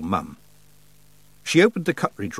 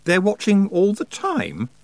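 high, slow, horrified question; aghast; 11 semitones higher, 4 dB louder, slower than usual. A narrator's reading. Tiffany learning the gods are watching her, aghast. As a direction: draw it out in rising disbelief. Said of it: "she said, aghast".

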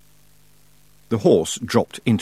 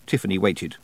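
ordinary delivery, nothing marked

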